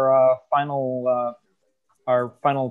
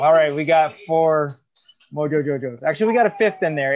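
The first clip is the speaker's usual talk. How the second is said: ordinary talk, nothing marked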